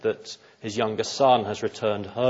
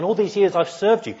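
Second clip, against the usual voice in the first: higher, louder bitter complaint; resentful son; 8 semitones higher, 3 dB louder than usual. Retold or imitated. Older son complaining to his father, resentful. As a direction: sound wronged and hard done by. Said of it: "That's what he says in verse twenty-nine".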